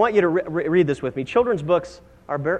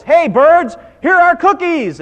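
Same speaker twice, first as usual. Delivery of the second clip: high, full-voiced holler; storybook yell, calling far and wide; loud shout; 13 semitones higher, 10 dB louder, slower than usual. A narrator's reading. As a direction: shout it out to the birds. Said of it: "he shouted in a loud voice".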